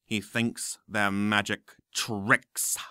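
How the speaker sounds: low, slow scornful drawl; slow sneering professor. Acted. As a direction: spit out the absurdity. Smape scornful.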